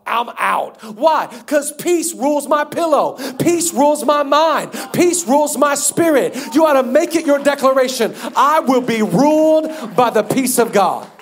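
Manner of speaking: loud, high, rhythmic declaration; preaching at full force. Real, not acted. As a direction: build to a ringing proclamation.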